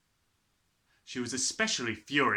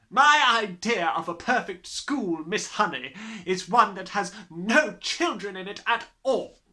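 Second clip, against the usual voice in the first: Trunchbull's booming voice; 7 semitones higher, 7 dB louder than usual. A narrator's reading. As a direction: sneer at the children from the front. Trunchbull declares her ideal school.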